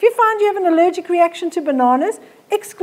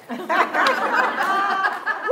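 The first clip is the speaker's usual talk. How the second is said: real laughter; 4 semitones higher, 4 dB quieter than usual. Real, not acted. Laughing at own story.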